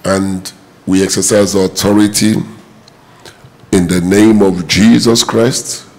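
low, steady prayer voice; praying aloud. Acted. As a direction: pray over the congregation. Leads a congregation prayer.

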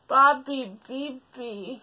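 very slow sighing call. Acted. Bear closes his eyes, sighing slow. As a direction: sigh it. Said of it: "sighed the bear".

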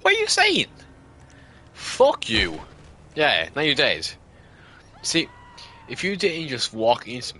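loud furious yell; angry shout. Real, not acted. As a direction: yell it right at them. Shouting at killed opponent.

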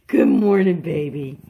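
ordinary talk, nothing marked

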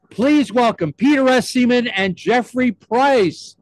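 high, loud announcer build-up; show host introduction. Real, not acted. Host announces guests to applause.

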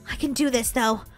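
low, soft pep talk to oneself; quiet self-encouragement. Pretend. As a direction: reassure yourself softly. Orphan steels herself.

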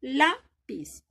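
Lápiz in two parts, loud LÁ, falling piz; stress on LÁ. Shown as a demonstration. Teacher repeating for pupils, Lápiz stressed on LÁ. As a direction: hit LÁ, then let piz fall away in Lápiz. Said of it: "Entonces suena más fuerte".

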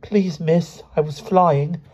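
put-on high, small child's voice; embarrassed boy owning up, not truly whispered; sheepish schoolboy. A narrator's reading. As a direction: confess timidly in a high pitch. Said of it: "he whispered".

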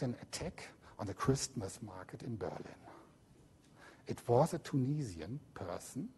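low whispered remark; hushed. Real, not acted. Lecturer whispering about the Berlin market attack. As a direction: say it under your breath, grave.

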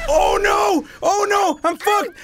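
high, loud panicked cry; panicked shouting. Real, not acted. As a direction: yell in panic as you fall. Falling in game.

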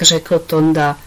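ordinary delivery, nothing marked

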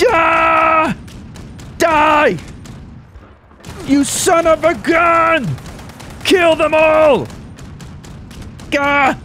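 battle yelling. Pretend. Gamer charging into a firefight, full-voiced battle yell. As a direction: scream your attack as you open fire. Firing at enemies in game.

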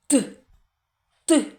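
very short hard "Т"; isolated consonant. Shown as a demonstration. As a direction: pop out "Т" alone, as a separate sound. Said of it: "Третій звук який?". (короткий окремий твердий звук "Т")

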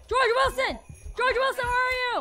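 high, loud summoning shout; loud, high call across the house. Real, not acted. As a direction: holler for someone who is hiding. Calling out to the ghost.

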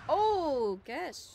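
loud sudden gasp of shock; surprised exclamation. Real, not acted. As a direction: blurt it out, startled.

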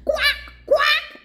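very high, loud quacks; duck quack. A narrator's reading. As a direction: quack sharply like a busy duck.

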